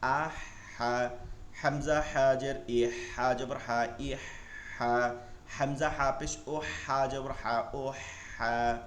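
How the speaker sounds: very slow, drawn-out syllable drill on a level tone; slow classroom spelling chant; spelling-out recitation. Shown as a demonstration. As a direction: stretch each syllable, pausing between them. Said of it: "বানান করে করে পড়বেন".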